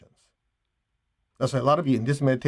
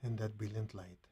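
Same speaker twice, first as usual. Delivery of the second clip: very soft, slightly lower meditation voice; soft, unhurried phrases with long pauses; guided meditation; 3 semitones lower, 15 dB quieter than usual. Acted. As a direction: gently guide them back from stillness, barely above a hush.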